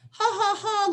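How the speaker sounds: high, hollow forced ha-ha; mock shallow laughter; fake laugh. Shown as a demonstration. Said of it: "this isn't like".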